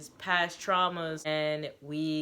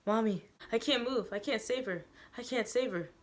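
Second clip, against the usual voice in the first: put-on higher, pleading child's voice; a child's panic, pitch raised; frightened child; 6 semitones higher than usual. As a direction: cry out helplessly for mommy. Child helpless as mother is hit.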